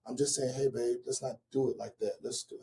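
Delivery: a hint of whisper; soft hushed voice. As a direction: hush it down like a secret to your daughter. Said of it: "whispering to you".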